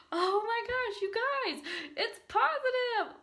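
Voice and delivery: high, breathy excited laugh; can't believe it, squeal softly; overjoyed hushed squeal; giddy disbelief. Real, not acted. Joyful disbelief at positive test.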